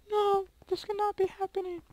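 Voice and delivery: very high squeaky mock despair; dramatic squeaky voice. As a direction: wail it in a squeaky voice. Dramatic despair after dying.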